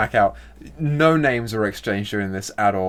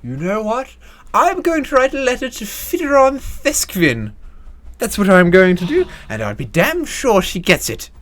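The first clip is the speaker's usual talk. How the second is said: much higher, louder haughty resolve; indignant society lady; 9 semitones higher, 5 dB louder than usual. Acted. Rich lady to Akan, much higher and louder indignant vow. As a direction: declare it up high and loud.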